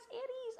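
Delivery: "Aires" jumped up high, correcting; "Aires" squeaked high, correcting herself; "Aires" fixed in a high yelp; high correction. Shown as a demonstration. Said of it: "I'm gonna butcher that".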